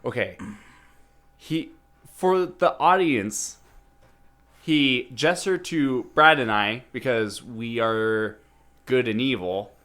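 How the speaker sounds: ordinary talk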